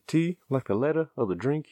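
rattled off fast in imitation; mimicking the quick clerk. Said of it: "And that fast, too".

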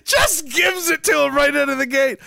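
laughing, shouting in delight; gleeful shouting at the stream; excited shout. Real, not acted. Cheering fighter's interview moment.

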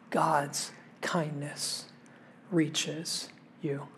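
low, very soft and slow, with pauses; hushed reassurance. Real, not acted. Preacher to those in a season of suffering, hushed reassurance. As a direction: say it gently, word by word.

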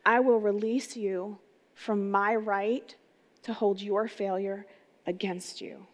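slow, weighing every word; slow and deliberate. Shown as a demonstration. Pastor repeating the forgiveness vow to the congregation, slow and deliberate. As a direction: lay each phrase down slowly so it sinks in. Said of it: "I'm gonna say that again".

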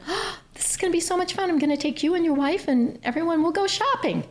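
high, bubbly and thrilled; gushing excitement. Retold or imitated. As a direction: squeal it like a delighted shopper. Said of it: "I stood up and I said".

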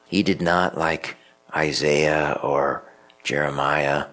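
ordinary talk, nothing marked

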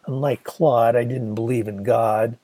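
plain reading voice

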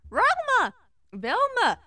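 high excited dog voice calling a name; Scooby-Doo overjoyed to see Velma. Acted. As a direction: bark the name out like a cartoon dog, high and loud.

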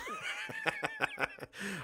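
tiny breathless squeak of laughter, very high; squeaky laugh. Real, not acted. Streamer laughs at a donor's line about commies, squeaky laugh. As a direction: giggle high in the throat.

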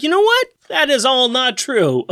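high-pitched mock protest; mock denial. Podcaster voicing the accused womanizer's denial, high mock protest. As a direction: imitate him protesting, voice pitched well up. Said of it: "and he's like".

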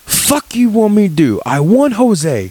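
hammed-up exasperated coworker with broken English. Retelling the coworker's outburst at the production meeting, high mock broken English. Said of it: "to give you his broken English".

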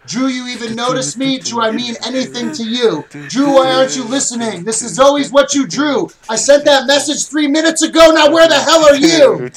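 needy voice rising to a yell; clingy partner voice. Pretend. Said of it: "voiced clingy partner".

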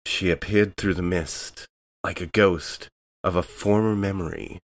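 ordinary talk, nothing marked

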